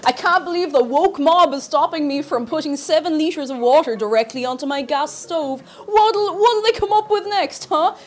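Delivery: loud, high sarcastic rant; mock-indignant outrage, hammed up; too much caffeine. Sarcastic rant about woke mob.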